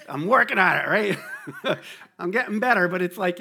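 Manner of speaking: laughing through the words; chuckling, admitting a fault. Real, not acted. Preacher laughs at his own yelling habit, laughing.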